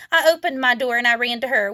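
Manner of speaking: ordinary delivery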